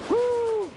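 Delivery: high sigh of relief; relieved whew. Acted. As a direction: blow out relief after a close call. Just escaped the train.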